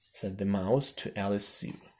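usual speaking voice

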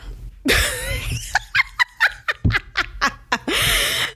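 a long, high cackle of laughter. Real, not acted. Laughing at how fake women are, long high cackle.

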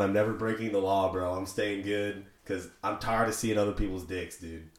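ordinary talk, nothing marked

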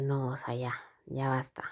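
soft whisper, telling herself to stop; whispered self-talk. Retold or imitated. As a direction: hush yourself, barely voiced.

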